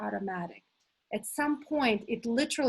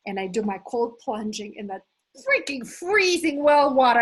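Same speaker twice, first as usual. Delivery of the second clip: usual speaking voice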